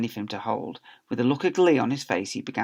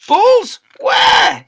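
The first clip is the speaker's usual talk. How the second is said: much higher, much louder yell; furious teacher shouting balls where; demanding shout; 15 dB louder than usual. Acted. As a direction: bark the demand at her. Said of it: "he demanded".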